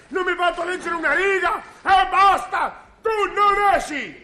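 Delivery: husband snapping at his nagging wife, furious shouting; furious outburst. Acted. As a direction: lose your temper completely and yell. Husband finally explodes at wife.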